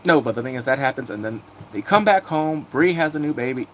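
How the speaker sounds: normal talking voice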